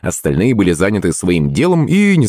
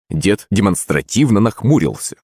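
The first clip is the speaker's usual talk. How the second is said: ordinary delivery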